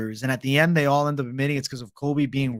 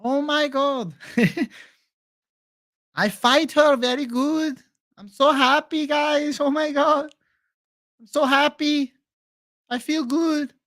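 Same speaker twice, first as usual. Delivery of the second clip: much higher, girlish put-on voice with a giddy laugh; squealing happy fighter voice, pitched way up; Amanda Hebos impression; 11 semitones higher, slower than usual. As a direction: gush like a thrilled fighter after a win. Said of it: "Amanda Hebos impression".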